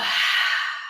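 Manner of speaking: long open-mouthed exhale sigh; audible sigh. Shown as a demonstration. Teacher demonstrating a releasing sigh for her class. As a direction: let the breath go with a sigh. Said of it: "open the mouth to let it go".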